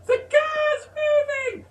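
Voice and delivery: put-on very high, slow little-boy yell; toddler scream. Toddler screaming out the window at his dad, very high. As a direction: wail it out the window. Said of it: "Eddie was screaming out the window".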